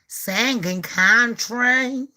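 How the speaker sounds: normal talking voice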